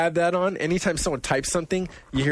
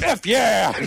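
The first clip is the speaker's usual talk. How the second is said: high, loud laughing shout; mock taunt; game taunt; 8 semitones higher, 5 dB louder than usual. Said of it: "my response is".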